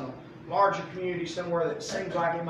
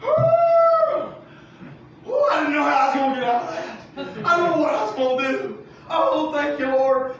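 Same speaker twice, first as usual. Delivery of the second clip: much higher, louder, rushed gasp of relief; relieved inner voice; 8 semitones higher, 8 dB louder than usual. Acted. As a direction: gush with thankful relief. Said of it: "what I think he was saying on the inside".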